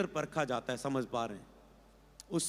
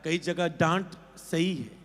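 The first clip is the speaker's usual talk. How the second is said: usual speaking voice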